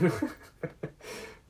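a high unvoiced burst of laughter. Real, not acted. Laughing at Charlie's answer in class, high breathy laugh.

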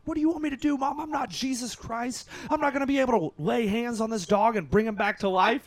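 high-pitched exasperated mock yelling; retold screaming. Son on the phone to his mom, retold frantic screaming. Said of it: "So I start screaming at my mom".

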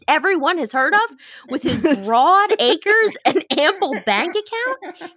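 incredulous snob, hammed up; mock indignation. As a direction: puff up with offended pride. Narrator acts Godfrey's outrage at insult.

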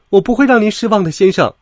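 high, loud and quick pledge; proud little engine reporting for duty. A narrator's reading. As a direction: answer the boss proudly, with full eagerness. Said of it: "他骄傲地喷着气说".

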